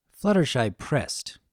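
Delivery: usual speaking voice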